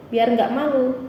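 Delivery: usual speaking voice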